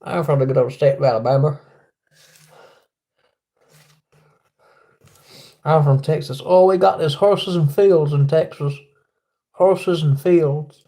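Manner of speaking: jokey Southern US twang; mock Southern accent. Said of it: "I said to Alabama".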